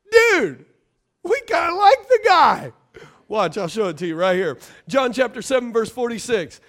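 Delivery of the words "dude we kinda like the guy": high, loud casual dude voice; soldiers impressed. Retold or imitated. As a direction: play it like a laid-back soldier, stunned. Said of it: "and they're like".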